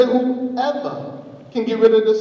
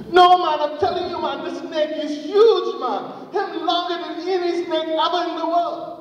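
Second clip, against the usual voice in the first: high-pitched, emphatic arguing; mock quarrel, pitched way up; staged insistence; 10 semitones higher than usual. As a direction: insist in a much higher voice. Anansi acts out staged argument.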